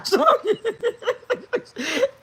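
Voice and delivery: long rising shriek of a laugh; high squealing laugh. Real, not acted. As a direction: let the laugh climb way up. Can't stop laughing at story.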